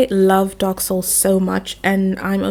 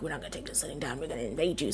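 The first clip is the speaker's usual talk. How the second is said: low muttered giant threat; 6 semitones lower, 15 dB quieter, much faster than usual. Retold or imitated. Giants muttering a threat at the invaded kingdom, low and hushed. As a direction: drop low and quiet, almost under the breath. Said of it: "They're like".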